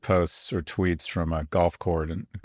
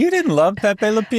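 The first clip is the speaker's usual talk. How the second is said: Pepe Le Pew voice; 13 semitones higher, 5 dB louder than usual. Pretend.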